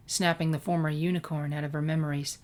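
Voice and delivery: ordinary delivery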